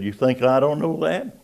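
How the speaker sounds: high, loud rhetorical retort; a boss scoffing at the sailor; gruff retort. Retold or imitated. Commander scoffs at the sailor, high and loud.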